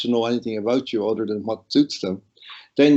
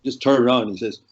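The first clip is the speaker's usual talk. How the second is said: ordinary talk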